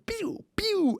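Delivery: gun sound effect. Retold or imitated. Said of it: "silenced pistol".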